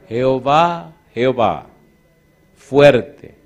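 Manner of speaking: loud ringing proclamation of the name; solemn proclamation. Acted. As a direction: proclaim it like from the mountain.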